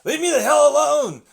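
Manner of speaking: high, loud hammed-up yell; mock panicked yell. Victim screaming at the tribe killer, high and loud. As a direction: shriek it at the attacker.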